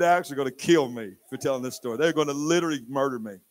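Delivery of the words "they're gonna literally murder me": ordinary talk, nothing marked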